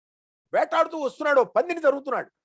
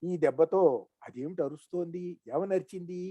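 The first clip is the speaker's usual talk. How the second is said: pitying murmur; 9 semitones lower, 6 dB quieter than usual. Acted. Sage pities wounded boar.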